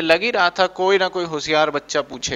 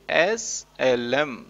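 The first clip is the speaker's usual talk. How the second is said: drawn out slow and tender, SLM stretched letter by letter; said lovingly; 2 semitones lower, much slower than usual. Shown as a demonstration. Teacher has the class say SLM fondly, very slowly. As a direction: croon SLM very slowly like a pet name. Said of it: "बोलो एक बार प्यार से".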